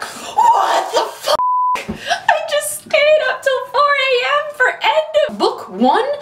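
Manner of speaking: very high, slow, shrieking disbelief; outraged disbelief. Retold or imitated. Reader finding the book ends on a cliffhanger, very high outraged shriek. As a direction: squeal it as if betrayed by the ending. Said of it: "And I'm like".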